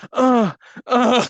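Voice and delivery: high, loud mock grunts; mock pleasure grunts. Mocking VR users grunting, high and loud. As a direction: grunt like enjoying the VR too much. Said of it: "they're like".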